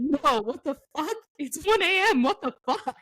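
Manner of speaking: high-pitched mock outrage; imitating an appalled friend's reply; incredulous. Acts out her incredulous texted reply.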